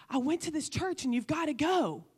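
high, eager mother gushing; imitating mom bursting with excitement; mother's excited voice. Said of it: "this sound in her voice".

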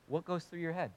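ordinary talk, nothing marked

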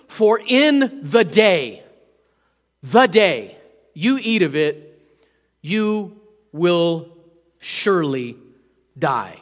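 slow, weighty, every phrase stressed; slow emphatic reading. Shown as a demonstration. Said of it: "look at this".

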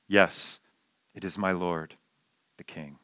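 soft, low, respectful answer fading to nearly nothing. Retold or imitated. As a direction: speak humbly to the king. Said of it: "respectful tone".

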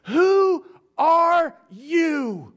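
a high, loud, drawn-out demand; secretary at her wit's end demanding his name; exasperated shout. Retold or imitated. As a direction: bark it at him like a fed-up gatekeeper. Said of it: "she is completely exasperated".

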